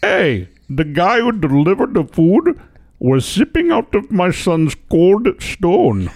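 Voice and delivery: high, slow mock Indian accent; hammed-up Indian accent voice. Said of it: "So he probably said".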